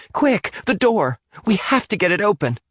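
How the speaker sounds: high, urgent yell. A narrator's reading. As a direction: yell for them to hurry. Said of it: "Amy yelled".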